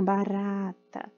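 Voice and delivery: "Barata" with a light tapped R, slow; soft tapped R. Shown as a demonstration. Teacher models the weak R in "Barata" slowly. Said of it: "Tá vendo que é fraquinho?".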